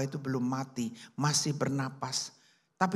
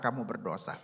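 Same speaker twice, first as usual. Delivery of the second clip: lower, softer echo of the accusation; fading ghost echo; 7 semitones lower, 5 dB quieter than usual. A narrator's reading. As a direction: let it fade like a spirit's echo. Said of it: "terus dihantui oleh roh-roh".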